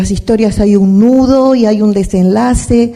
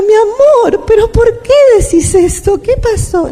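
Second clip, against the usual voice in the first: much higher, louder doting voice; tender grandma; 12 semitones higher, 4 dB louder than usual. Retold or imitated. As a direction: coo at the little grandson. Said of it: "le digo".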